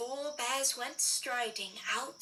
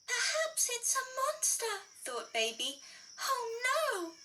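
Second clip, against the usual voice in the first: worried; 9 semitones higher than usual. Acted. Baby thinks, scared.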